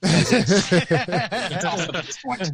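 slow, drawn out through a laugh; laughing it off, slowly. Real, not acted. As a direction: savor the joke while laughing. Laughing at being blocked.

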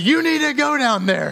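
high, loud commanding wife voice; insistent wife. Retold or imitated. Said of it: "And she said".

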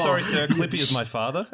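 a touch of pompous outrage; mock indignant. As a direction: play an offended son of Clippy. Joking as Clippy's child.

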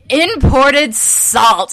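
drawn-out hammed-up title read; excited. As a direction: savour each word like a big reveal. Reading salty Twitter name.

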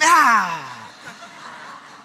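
loud laugh breaking out mid-story; burst of real laughter. Real, not acted. Pastor laughs out loud at his own story, a loud burst of laughter.

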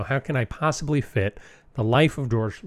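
normal talking voice

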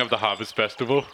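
put-on slipping Austrian-Russian accent; Austrian turning Russian accent. Hostage asking what they mean, Austrian accent turning Russian. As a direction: put on a loose Eastern European, Russian-tinged accent. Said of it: "it's getting more and more Russian".

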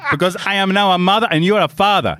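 high, mock-indignant German-tinged accent; German-sounding accent. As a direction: play the complaining wife with an Italian accent gone German. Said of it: "now I'm German".

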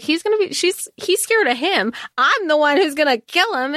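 high-pitched, exasperated retelling; eye-rolling indignation. Retold or imitated. Acting out Dree rolling her eyes at a begging man, indignant. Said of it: "like, rolling her eyes".

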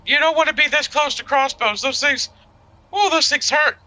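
high, light in-character voice. Acted. In-character warning continues.

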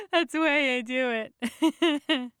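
giggling through the words; chuckling, pleased with the prank; laughing. Acted.

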